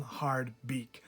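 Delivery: ordinary delivery, nothing marked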